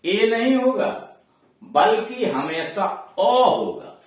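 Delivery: नहीं punched high and loud; firm correction, stressing नहीं. Shown as a demonstration. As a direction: hit नहीं hard, then अ. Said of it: "तो उसका उच्चारण".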